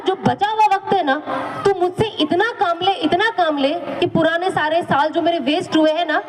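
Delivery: ordinary talk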